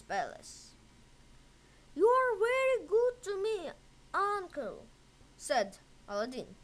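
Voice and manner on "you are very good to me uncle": put-on higher, quick grateful boy; eager thanks from the boy; boy's voice. A narrator's reading. Aladdin thanks his uncle, higher and quick.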